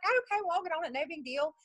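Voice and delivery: high-pitched, easygoing reply; retold casual agreement. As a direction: pitch it up, brushing it off.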